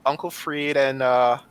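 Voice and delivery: high, sweet coaxing voice; babysitter voice. Acted. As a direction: coax it like a toddler. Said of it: "put on my, uh, babysitter voice".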